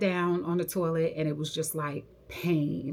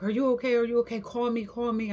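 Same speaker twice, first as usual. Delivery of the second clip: higher, urgent retold fretting; worried; 7 semitones higher than usual. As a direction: imitate someone anxiously checking on a friend. Worried friend in retold scene.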